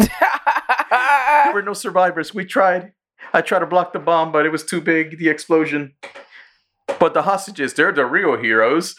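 high, hammed-up grief for the press; mock tearful statement. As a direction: feign heartbreak as a failed rescuer. Retold fake-grieving press statement.

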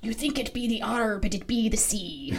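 lightly voiced pirate punchline. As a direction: deliver the joke like a pirate.

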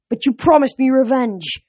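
high, loud protest. A narrator's reading. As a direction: protest hotly to your general. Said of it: "Thorne protested".

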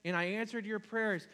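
exasperated God. Acted. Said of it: "And God says".

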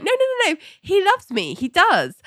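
high-pitched mock protest; not satisfied, protesting. Ali rejecting the therapist's advice, protesting. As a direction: insist in rapid, pleading denial. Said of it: "Ali's not satisfied with this advice".

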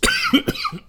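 sharp harsh cough; quick rough cough; chesty cough. Real, not acted. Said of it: "excuse me".

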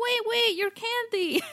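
high, loud calling out; calling after them. Retold or imitated. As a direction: shout after the fleeing kids. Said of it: "I was like".

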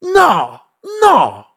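very high indignant complaint; whiny angry brother. Retold or imitated. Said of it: "he's pissed off because he's like".

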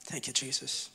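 hushed thanks. Real, not acted. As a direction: drop to a near-breath after the peak. Quiet aside after climax.

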